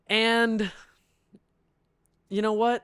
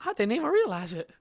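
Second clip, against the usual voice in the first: mock innocent Karen; 9 semitones higher than usual. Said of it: "She said".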